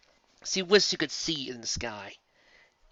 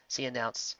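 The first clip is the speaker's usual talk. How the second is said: ordinary delivery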